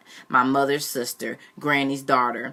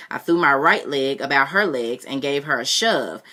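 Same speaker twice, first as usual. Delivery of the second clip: ordinary delivery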